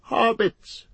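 high-pitched cry of recollection; sudden cry. A narrator's reading. Innkeeper suddenly remembering something, crying out. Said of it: "he cried".

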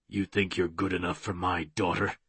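low, soft, menacing villain voice; creepy taunting villain. A narrator's reading. Villain taunting his former prisoner, creepy and soft. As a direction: taunt him quietly and low.

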